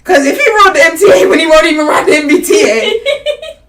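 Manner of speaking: high, loud, laughing jab; loud, amused mockery; laughing ridicule. Real, not acted. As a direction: scoff loudly through laughter.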